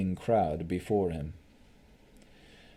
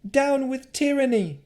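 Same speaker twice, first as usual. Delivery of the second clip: rallying cry; 5 dB louder than usual. Acted. Woman rallies crowd.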